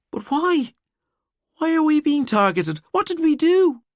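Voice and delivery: very high, strained pleading; frightened plea. A narrator's reading. Emer pleading frightened with the detectives. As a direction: beg for an answer, scared.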